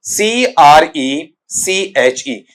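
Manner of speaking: very slow "सीआरईसीएचई", letter by letter with a gap midway; spelled out. Shown as a demonstration. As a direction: dictate "सीआरईसीएचई" one letter at a time. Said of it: "एक बार फिर बोल रहा हूं". ("सीआरईसीएचई" के अक्षर रुक-रुक कर गिनाएं)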